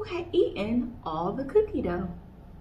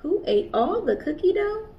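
higher, louder questioning call; curious kangaroo asking; 8 semitones higher, 4 dB louder than usual. Acted. Kanga asking each animal.

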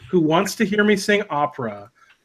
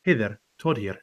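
soft, lower Todd voice; hushed reviewer voice as Todd; soft-spoken vape reviewer Todd; Todd impression; 5 semitones lower, 6 dB quieter than usual. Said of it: "I love watching Todd because he's".

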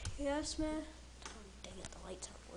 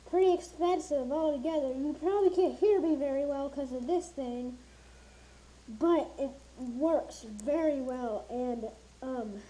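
muffled behind respirator mask; speaking inside face mask; through a gas mask; 3 semitones higher, 5 dB louder than usual. Real, not acted. Seen 1: Respirator gas mask covers mouth throughout; reference frames show bare face.

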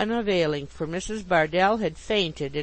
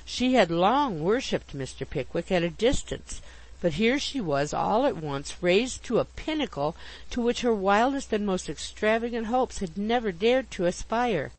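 usual speaking voice

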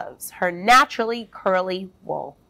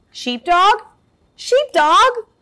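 high rising calls, climbing louder; calling out; 11 semitones higher, 4 dB louder, slower than usual. Acted. Sheep looking for Sheepdog, rising calls. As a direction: call for him across the farm.

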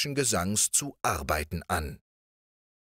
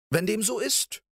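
much higher eager prince; prince calls louder. A narrator's reading. Riquet calls to the princess, much higher and eager. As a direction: call out, eager and triumphant. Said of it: "rief Riquet mit dem Schopf noch lauter".

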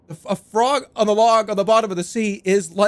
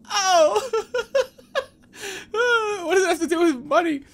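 much higher, sobbing laughter between repeats; real laugh-crying, barely able to speak; 10 semitones higher than usual. Real, not acted. Streamer to chat about the bet math, crying with laughter.

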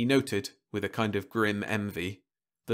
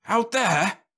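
horrified; 11 semitones higher, 4 dB louder than usual. Acted. Horrified at thrown-out tablets.

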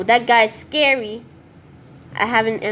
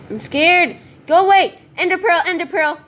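high, loud and hurried; real panic; 8 semitones higher, 6 dB louder than usual. Real, not acted. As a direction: scramble away in a fright. Fleeing, grabbing ender pearl.

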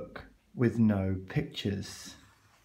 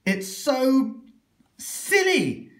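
much higher squeak; squeaky protest; 10 semitones higher, slower than usual. Acted. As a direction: squeak in complaint. Reader protests.

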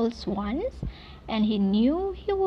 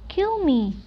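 higher-pitched pleading cry; 7 semitones higher, 4 dB louder than usual. A narrator's reading. Poor duckling to the swans, crying out. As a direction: cry it out high, as a poor creature. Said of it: "cried the poor creature".

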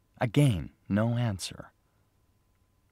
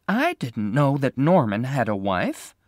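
surprised; 5 semitones higher, 5 dB louder than usual. Acted.

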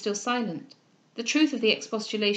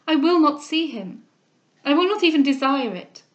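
distressed vow; 4 semitones higher, 7 dB louder than usual. Acted. Cecilia yields in distress.

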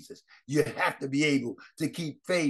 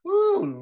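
ecstatic cry; 6 dB louder than usual. Real, not acted. Preacher's ecstatic exclamation.

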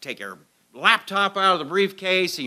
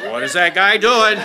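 mocking guards; 10 dB louder than usual. Imagined security guards watching.

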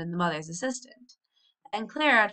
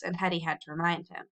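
plain reading voice

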